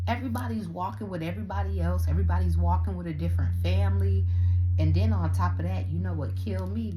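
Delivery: loud, fast outraged rant. Real, not acted. Vlogger ranting at a scattered Disney family, loud and fast. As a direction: blast the family for walking apart.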